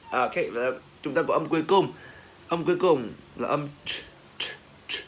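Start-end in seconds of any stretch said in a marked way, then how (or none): 4.4-5.0 "ch" as a bare popped consonant. Shown as a demonstration. Teacher drilling the final ch sound, "ch" popped out. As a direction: release each "ch" sharply, no vowel. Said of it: "Bật ra".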